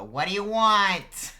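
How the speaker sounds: high, slow, loud mom holler; older feminine voice. Acted. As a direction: yell back at your kid from another room. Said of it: "older feminine voice go".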